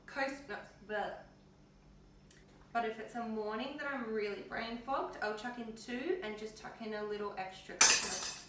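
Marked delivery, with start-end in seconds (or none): none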